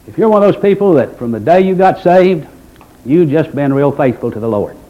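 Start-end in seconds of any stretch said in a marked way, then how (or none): none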